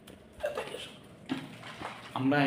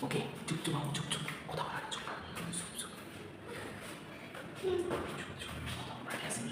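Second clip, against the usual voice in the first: hushed sneaky whisper; 3 semitones higher, 16 dB quieter than usual. Real, not acted. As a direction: whisper so nobody hears. Sneaking in, hushing.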